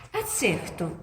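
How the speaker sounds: ordinary talk, nothing marked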